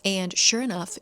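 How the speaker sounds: usual speaking voice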